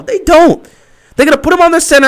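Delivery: high, loud and fed up; exasperated shout. Real, not acted. Fan ranting at his team's coaches, exasperated. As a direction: throw it at the screen in frustration.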